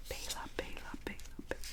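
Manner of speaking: very soft ASMR whisper; ASMR pronunciation. Shown as a demonstration. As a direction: whisper the name over and over, barely audible. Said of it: "in an A S M R pronunciation video".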